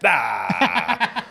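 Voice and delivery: laughing mock corpse "Ah"; open-mouthed dead man groan.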